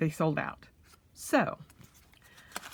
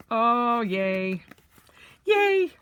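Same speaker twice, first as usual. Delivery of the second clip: excited squeal; 6 semitones higher, 5 dB louder, much slower than usual. Real, not acted. Delighted unboxing reaction.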